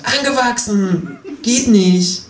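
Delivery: high, slow, annoyed over-articulation; annoyed, over-clear. Acted. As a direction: spell it out, fed up. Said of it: "sagt überdeutlich und genervt".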